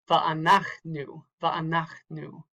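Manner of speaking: slow "va'anachnu" with the weight on "nach"; stress before last syllable. Shown as a demonstration. Teacher showing a marked accent, "va'anachnu" slowly stressed on "nach". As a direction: lean on "nach" in "va'anachnu", not the final "nu". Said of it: "that is the accented syllable".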